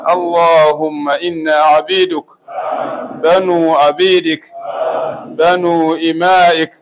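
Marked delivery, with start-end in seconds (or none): none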